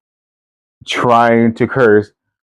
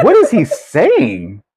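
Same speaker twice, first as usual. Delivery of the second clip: high baffled, laughing; 12 semitones higher, slower than usual. Retold or imitated. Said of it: "They'd be like".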